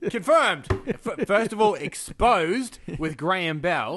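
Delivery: high, loud, triumphant proclamation; mock exposé announcer. Host publicly exposing Graham Bell, mock-triumphant. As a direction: declare it grandly, stretching the big word.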